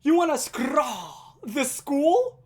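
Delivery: loud, high and very slow, every word stretched; slow drawn-out yell. Retold or imitated. Classmate repeating his taunt at Lewis, slow drawn-out yell. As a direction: drag the taunt out like a slurred chant. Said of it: "yell this at the top of his lungs".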